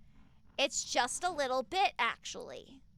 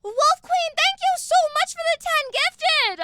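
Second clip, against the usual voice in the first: very high, loud, squealing thanks; excited squeal; 13 semitones higher, 9 dB louder, slower than usual. Real, not acted. Streamer squealing thanks at a donor, overjoyed. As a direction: shriek with delight at the gift.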